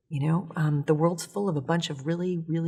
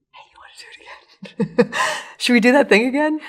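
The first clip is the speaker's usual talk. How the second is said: higher, breathy-free hushed coaxing whisper then louder laugh; teasing whisper; 8 semitones higher, 9 dB louder than usual. Pretend. Inspiration nudging bruised you each morning, teasing whisper. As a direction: whisper it like a mischievous friend. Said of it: "inspiration will be like".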